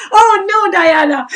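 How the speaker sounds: amused dismay. Real, not acted. Teacher teases viewer Diana for using her phone, amused dismay. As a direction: scold her playfully through a laugh.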